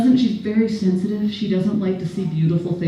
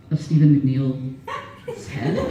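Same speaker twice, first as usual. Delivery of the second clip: ordinary delivery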